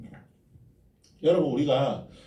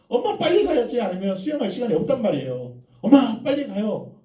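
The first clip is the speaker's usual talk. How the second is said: put-on high, loud whiny kid's voice; impatient child; 11 semitones higher, 6 dB louder than usual. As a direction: nag mom like an eager little boy. Child urging mother to hurry. (높고 큰 소리로 조르는 아이 목소리)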